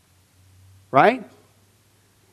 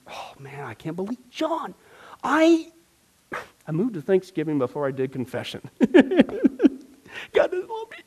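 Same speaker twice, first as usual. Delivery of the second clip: mock fretting prayer; 3 semitones lower, 6 dB quieter than usual. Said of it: "Instead of going".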